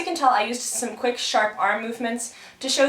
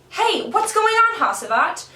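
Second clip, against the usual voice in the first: much higher, louder shout of protest; irritated Karagos; 10 semitones higher, 4 dB louder than usual. Acted. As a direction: yell at the noisy neighbour. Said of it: "Karagos wants to sleep".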